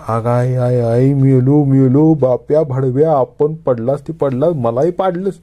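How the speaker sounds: high, loud cry of pain bursting out; pained outcry. Acted. Narayan after falling onto a stranger cries out loud in pain. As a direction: yell as if you just crashed down hard. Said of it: "न राहून तो ओरडला".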